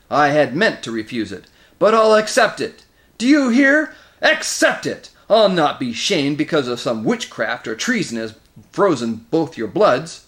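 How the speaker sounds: furious outburst, high and loud; roaring. A narrator's reading. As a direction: bawl defiance at the two lords. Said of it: "roared Moraz".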